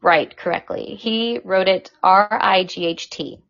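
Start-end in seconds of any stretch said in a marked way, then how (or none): 0.0-0.3 "right" punched loud and clipped with a falling tune; "right" said loud, set apart by a pause; stress on right. Shown as a demonstration. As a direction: hit "right" hard to mark the misspelling. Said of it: "he did not write "right" correctly".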